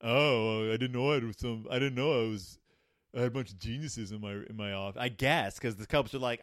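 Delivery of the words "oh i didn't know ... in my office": mocking, sarcastic doctor in a changed voice, a bit faster at the end. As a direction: sneer at the know-it-all kids. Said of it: "she's gonna get like".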